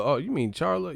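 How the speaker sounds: ordinary talk, nothing marked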